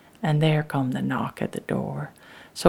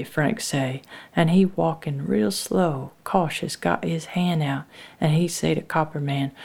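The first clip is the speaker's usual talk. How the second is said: usual speaking voice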